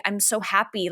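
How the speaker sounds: normal talking voice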